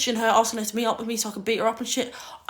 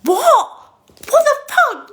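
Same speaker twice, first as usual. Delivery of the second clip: retold disbelief; 13 dB louder, slower than usual. Retold shock at the lie.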